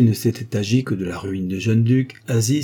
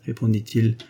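ordinary delivery, nothing marked

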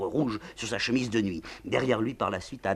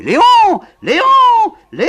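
sharp piercing call from upstairs; peacock-like shriek; piercing cry; 21 dB louder, much faster than usual. Acted. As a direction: shriek the name. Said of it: "le cri éclate là-haut".

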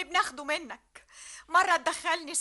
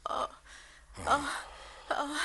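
ordinary delivery

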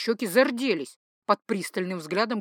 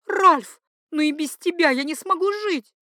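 high, loud cry of despair; 8 semitones higher, 6 dB louder than usual. A narrator's reading. Duchess cannot live without her lover, despairing. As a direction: plead as the frantic mistress, pitched high. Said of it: "В голосе прозвучало отчаяние".

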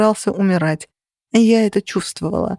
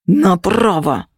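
drawn-out command; much slower than usual. A narrator's reading. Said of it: "комментировали мне на ухо".

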